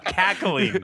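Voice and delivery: laughs through the word, high and bubbling; can't hold back a real laugh. Real, not acted. Telling a friend about the billionaire's reaction, laughing.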